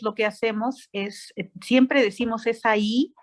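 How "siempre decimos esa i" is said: usual speaking voice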